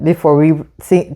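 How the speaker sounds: ordinary delivery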